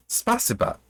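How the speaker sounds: "Spasiba" said as spa-SEE-ba, pitched high, a reader's guess at the Russian; a shaky stab at "Spasiba", high and unsure of the Russian; "Spasiba" tried in a lightly Russian way by a reader unsure of the sounds; attempted Russian. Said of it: "I got that pronunciation wrong".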